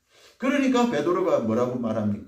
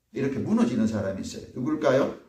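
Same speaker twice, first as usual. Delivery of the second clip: usual speaking voice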